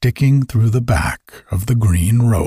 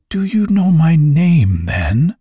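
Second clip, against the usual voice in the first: mildly astonished, pitch lifted then dropping; a touch of amazed questioning; 7 semitones higher than usual. A narrator's reading. Toinette amazed that the elf knows her name, mildly astonished. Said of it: "cried Toinette, astonished".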